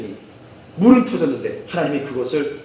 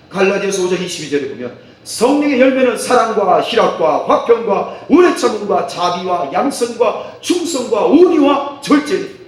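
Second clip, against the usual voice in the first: 설교; 4 semitones higher, 5 dB louder than usual. Acted. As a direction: preach each item with rising force. Preaches at full force. (힘주어 길게 늘이는 설교)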